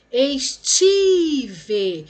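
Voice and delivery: ESTIVE with TI held long and loud; stretched stressed syllable. Shown as a demonstration. Teacher finds the stressed syllable for pupils, stretched stress. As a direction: call out "Estive", dragging the TI. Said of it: "parou no pá".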